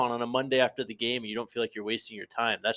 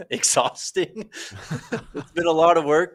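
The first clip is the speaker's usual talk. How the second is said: laughing; 5 semitones higher, 4 dB louder than usual. Real, not acted. Laughing through the answer.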